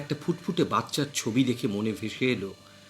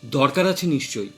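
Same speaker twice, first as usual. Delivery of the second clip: usual speaking voice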